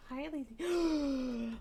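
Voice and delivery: slow drawn-out greeting. Real, not acted. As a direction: stretch it sweetly for the cat. Greeting cat slowly.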